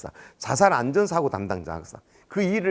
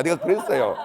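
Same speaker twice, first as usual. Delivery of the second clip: ordinary talk, nothing marked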